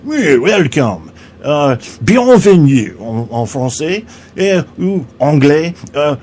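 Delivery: slow, drawn-out mock French welcome; hammed-up French accent. French vagina greets visitors on two long legs, hammed-up French accent. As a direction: play a snooty French greeter, very broadly.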